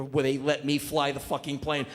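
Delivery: ordinary delivery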